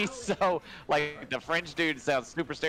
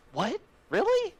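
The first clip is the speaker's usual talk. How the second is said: mock surprise; 4 dB louder than usual. Mock disbelief at engine deal.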